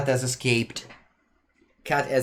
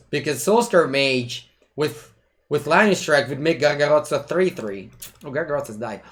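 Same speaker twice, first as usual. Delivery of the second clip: ordinary talk, nothing marked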